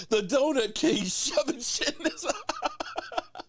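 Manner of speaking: slow, laughing repeat. Real, not acted. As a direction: get the words out through real laughter. Repeats the clip line while laughing.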